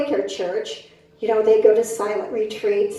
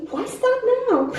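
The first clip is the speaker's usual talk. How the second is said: higher, laughing sassy retort; cheeky comeback; 11 semitones higher, 3 dB quieter than usual. Retold or imitated. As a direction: toss it back with a grin. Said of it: "All I could come back with was".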